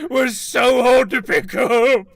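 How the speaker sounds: excited shout. Real, not acted. Joking about the metaphor.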